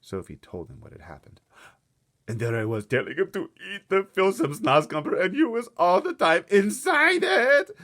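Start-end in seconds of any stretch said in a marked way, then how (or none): 2.3-7.7 rising higher and louder, nearly crying; anguished cry. Acted. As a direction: cry out in horror at your mistake. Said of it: "the B F G cried".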